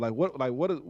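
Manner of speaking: ordinary talk, nothing marked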